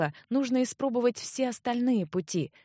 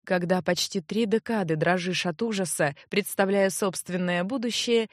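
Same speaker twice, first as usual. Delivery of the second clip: plain reading voice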